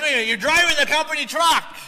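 indignant onlooker. Retold or imitated. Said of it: "'Cause you're like".

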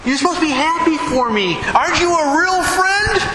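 put-on high, hurt, indignant young woman; offended young lady. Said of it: "the lady was offended".

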